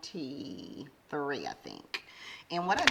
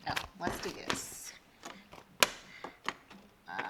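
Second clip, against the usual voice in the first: whispered aside; 6 dB quieter than usual. Real, not acted. Muttering to herself while counting.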